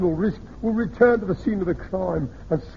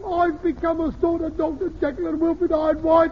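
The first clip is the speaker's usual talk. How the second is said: mock sleep-talker cry; 11 semitones higher than usual. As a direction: imitate the son crying out in his sleep, much higher. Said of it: "he cried out in his sleep".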